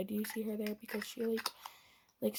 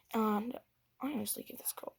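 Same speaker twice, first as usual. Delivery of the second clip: ordinary talk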